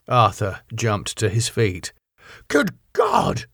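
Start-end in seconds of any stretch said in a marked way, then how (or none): 2.5-3.5 high-pitched cry of horror; shocked cry. A narrator's reading. Arthur jumping to his feet at Van Helsing, shocked cry. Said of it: "he cried".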